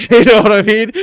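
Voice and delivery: high, loud laugh through the words; cracking up at his own joke. Real, not acted. Laughing at own mock riddle.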